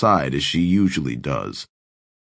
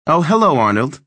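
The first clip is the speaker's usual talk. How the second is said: polite put-on voice; 9 semitones higher, 5 dB louder than usual. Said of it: "adopting the voice she reserves for".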